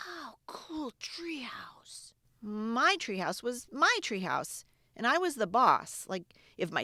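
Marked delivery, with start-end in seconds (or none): 0.1-2.1 breathless, whispered wonder; awed whisper. Acted. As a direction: whisper it like you just spotted treasure. Jeremy sees the treehouse.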